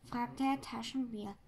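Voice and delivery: plain reading voice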